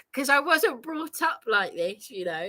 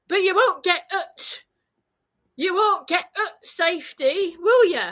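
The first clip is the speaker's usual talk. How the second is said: higher, put-on Northern English voice; exaggerated Northern accent, pitched up; John's mocked Northern accent; 6 semitones higher, 4 dB louder than usual. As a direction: mimic a Northern man with sing-song mockery. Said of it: "now I am mocking your accent".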